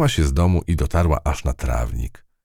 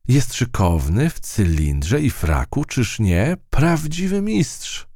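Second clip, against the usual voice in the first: admiring; 9 semitones higher, slower than usual. A narrator's reading. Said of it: "rozpoczęła opowieść chrobotka".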